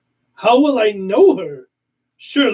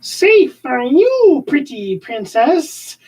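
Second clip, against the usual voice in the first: taunting villain; 11 semitones higher, slower than usual. Acted. Catra taunting from outside.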